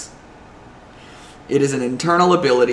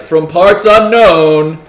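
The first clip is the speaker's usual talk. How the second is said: slow booming ring announcer call; 3 semitones higher, 9 dB louder, slower than usual. Retold or imitated. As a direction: draw it out like a fight announcer. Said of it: "do you just wanna be like".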